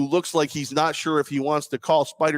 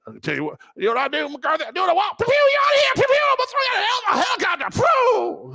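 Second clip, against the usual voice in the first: mock owner rant. Ranting as the Cowboys owner firing his coach, high mock bravado. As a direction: crow like a lawless rich owner.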